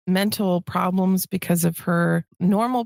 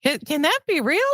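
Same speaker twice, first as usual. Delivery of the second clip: higher, disbelieving question; incredulous; 7 semitones higher than usual. Retold or imitated. Sister recalls hearing not everyone has inner monologue, incredulous. Recalls her own astonished thought.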